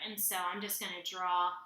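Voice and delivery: ordinary delivery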